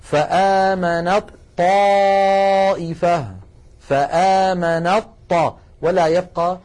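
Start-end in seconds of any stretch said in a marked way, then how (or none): 0.0-3.3 very slow "فآمنت طائفة" with ت merged into a doubled ط; إدغام التاء في الطاء بتلاوة بطيئة; slow recitation. Shown as a demonstration. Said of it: "ويصير النطق هكذا". 3.8-5.6 drawn-out "فآمنت طا" with a heavy doubled ط; نطق بطيء للطاء المشددة; slow demo. Shown as a demonstration. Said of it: "ويصير النطق هكذا".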